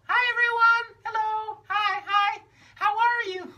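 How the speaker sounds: very high, loud, bouncy puppet voice. Acted. [name] greets the audience, very high and eager.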